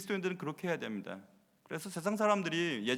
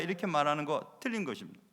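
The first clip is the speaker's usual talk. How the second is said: ordinary delivery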